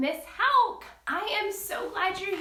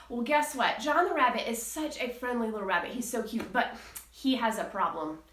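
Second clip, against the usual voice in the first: ordinary talk, nothing marked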